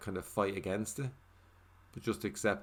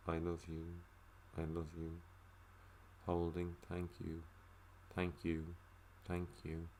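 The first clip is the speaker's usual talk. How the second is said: softer and softer mantra, fading to almost nothing; guided breathing meditation; 2 semitones lower, 8 dB quieter than usual. Shown as a demonstration. Demonstrating the forgiveness meditation on the breath, fading softer. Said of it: "So, for example".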